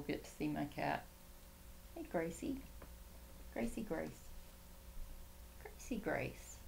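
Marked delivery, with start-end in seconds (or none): 1.9-6.6 softly calling the cat by name. Real, not acted. Owner softly calls her cat Gracie over. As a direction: coax the cat over quietly.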